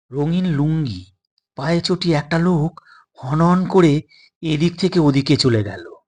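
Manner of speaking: ordinary delivery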